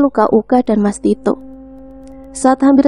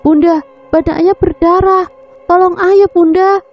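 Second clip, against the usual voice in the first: put-on a terrified child shrieking to mother; child's hysterical scream; 7 semitones higher than usual. A narrator's reading. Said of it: "Uka menjerit histeris".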